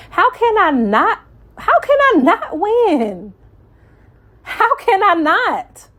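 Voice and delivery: soaring high, emphatic rhetorical question; fervent self-talk. Retold or imitated. Preacher telling herself she cannot lose, fervent and high. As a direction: lift it high like you're convincing yourself. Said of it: "is what I tell myself".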